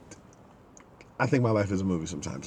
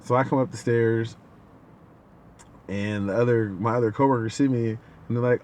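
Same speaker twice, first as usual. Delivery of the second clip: usual speaking voice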